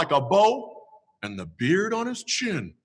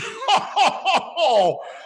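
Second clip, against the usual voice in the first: Santa laugh; 13 semitones higher than usual. Acted. Santa laughs after song.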